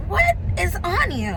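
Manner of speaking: high, disbelieving what is on you; shocked at a free drink; incredulous question. Retold or imitated. To the barista, asking in high disbelief.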